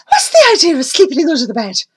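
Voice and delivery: loud, high and fast; demanding. Acted. Amy demanding to know why Dennis sleeps under the bed, loud and fast. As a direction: demand an answer quickly. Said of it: "Amy demanded".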